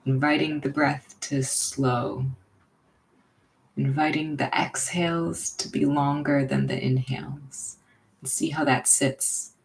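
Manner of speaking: unhurried, leave room for each breath; guided meditation. Real, not acted. Leading listeners into belly breathing, guided meditation.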